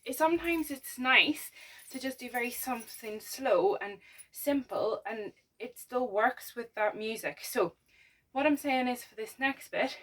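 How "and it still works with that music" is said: ordinary talk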